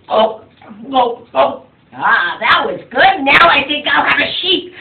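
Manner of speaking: loud, satisfied giant's voice; a hint of a greedy giant smacking lips; giant eating. Giant voiced savouring his meal.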